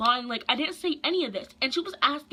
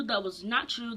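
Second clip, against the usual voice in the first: usual speaking voice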